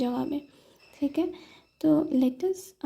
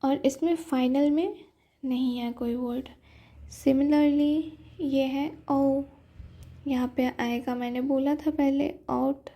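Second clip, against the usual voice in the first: ordinary delivery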